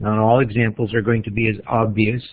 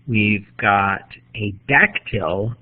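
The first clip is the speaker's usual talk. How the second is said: normal talking voice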